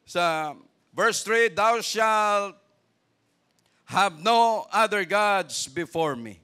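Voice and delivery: loud, slow, high proclamation of the commandment; full-force preaching. Acted. Preacher reading verse three to the church, loud slow proclamation. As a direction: thunder it out word by word.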